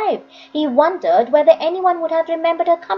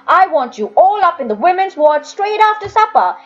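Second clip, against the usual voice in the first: commanding master; 4 semitones higher, 4 dB louder than usual. A narrator's reading. Master orders the boys upstairs.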